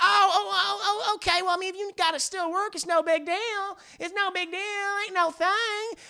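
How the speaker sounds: hammed-up Southern wife, stammering then breezy; Bridget. Wife tells husband he can keep working, high Southern accent. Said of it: "She has a very deep Southern accent".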